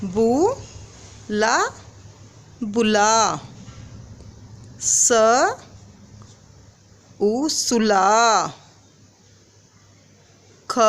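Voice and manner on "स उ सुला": very slow, vowel then word; slow sounding out. Shown as a demonstration. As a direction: stretch it out for children. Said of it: "बू से बनाएंगे हम".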